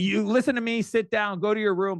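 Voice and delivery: mock angry parent. Father scolding his fighting kid, mock angry. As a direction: bark at the kid like a fed-up dad. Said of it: "I can't come in there fighting".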